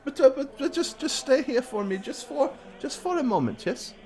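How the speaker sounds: high, soft, fawning cleric voice; high priest voice. Acted.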